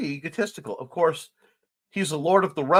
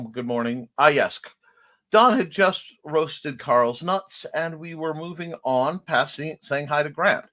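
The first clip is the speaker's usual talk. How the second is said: ordinary delivery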